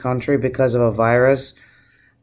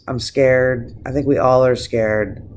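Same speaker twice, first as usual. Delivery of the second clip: usual speaking voice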